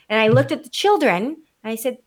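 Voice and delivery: ordinary delivery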